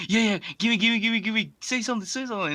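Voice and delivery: high, excited mock voice, pleading; hammed-up eager begging; eager Harry.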